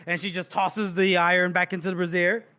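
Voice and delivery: usual speaking voice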